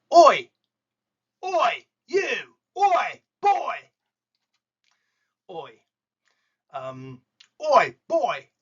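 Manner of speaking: high, loud London shout of oi. Shown as a demonstration. Teacher demonstrating oi as a high, loud London street call. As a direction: bark oi, you like a cockney calling someone out. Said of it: "I went to London now".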